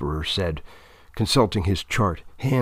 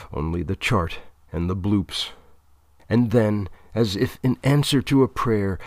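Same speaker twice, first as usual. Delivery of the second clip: ordinary delivery, nothing marked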